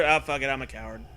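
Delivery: ordinary talk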